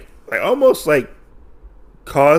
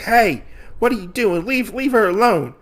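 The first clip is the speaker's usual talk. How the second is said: raised, high-pitched challenge; mock indignant protest; 9 semitones higher than usual. Usagi defending the other prisoner from the wolf, mock indignant protest. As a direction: act out standing up to a bully.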